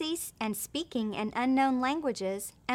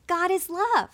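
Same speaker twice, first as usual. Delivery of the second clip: mild mock shout, pitched higher; retold battle shout; 6 semitones higher, 4 dB louder than usual. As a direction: lift it like a restrained battle cry. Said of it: "they were shouting".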